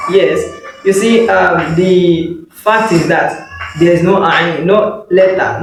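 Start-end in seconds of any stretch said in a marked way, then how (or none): none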